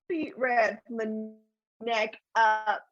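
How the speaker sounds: ordinary talk, nothing marked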